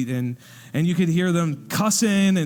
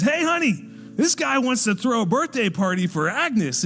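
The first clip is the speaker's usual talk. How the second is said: high-pitched call to the back room; 6 semitones higher than usual. Retold or imitated. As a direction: holler excitedly for your wife. Calling to wife in the back.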